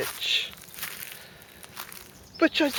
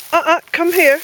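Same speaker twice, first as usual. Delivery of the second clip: sharp, loud, higher call to the dog; 8 semitones higher, 12 dB louder than usual. Real, not acted. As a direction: warn the dog off, loudly. Warning the dog away.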